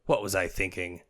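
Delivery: ordinary talk, nothing marked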